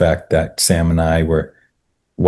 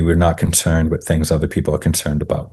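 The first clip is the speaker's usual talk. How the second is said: ordinary delivery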